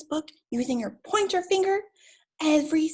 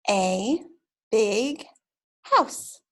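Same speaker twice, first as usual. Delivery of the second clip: word by word; 2 semitones lower, much slower than usual. Shown as a demonstration. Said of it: "Can you do that again?".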